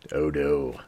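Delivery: ordinary talk, nothing marked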